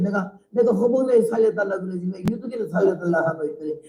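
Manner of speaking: ordinary talk, nothing marked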